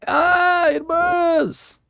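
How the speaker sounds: high, drawn-out shriek of fake agony; mock burning scream. Joker feigns burning from holy water, mock scream. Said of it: "Just kidding".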